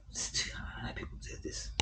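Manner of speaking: very soft, whispery, trailing away; weary murmur. Real, not acted. Worn-out worker wondering how people do this, weary murmur. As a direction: murmur it to yourself, almost voiceless.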